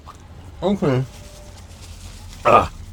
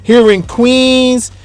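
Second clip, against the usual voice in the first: raised, slow show-host opener; vlog intro; 6 semitones higher, 6 dB louder, slower than usual. Acted. Host greeting his viewers, announcing the adventure in Queens. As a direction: announce the food adventure to viewers, slowly and high.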